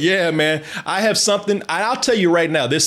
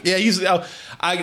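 ordinary talk, nothing marked

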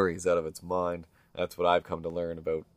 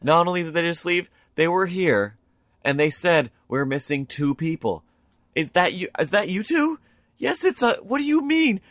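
very high, worked-up voices; acted incredulous exchange; 10 semitones higher, 3 dB louder than usual. Lady reveals missing two people, high incredulous retelling. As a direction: push the pitch up as disbelief mounts. Acted lady's revelation and stunned reply.

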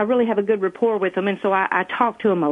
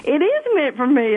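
higher, lightly acted overwhelmed cry; mock-tearful realisation, raised pitch; retold tearful amazement; 9 semitones higher than usual. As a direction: quote your own astonished reaction, pitched up. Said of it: "I just started crying".

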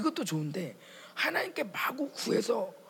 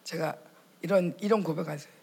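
ordinary delivery

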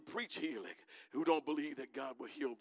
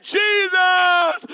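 much higher, much louder desperate cry of "Jesus"; lepers crying out; 13 semitones higher, 16 dB louder than usual. Acted. Lepers calling to Jesus from a distance, desperate shout. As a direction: scream his name across the crowd. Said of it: "They made a loud noise".

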